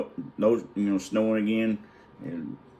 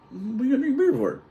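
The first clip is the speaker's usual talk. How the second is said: high-pitched, put-on disbelief; mock incredulous question; faster than usual. Uncle challenging the other about drinking beer, mock incredulous. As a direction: imitate a baffled challenge, pitched way up. Uncles bickering over the contest.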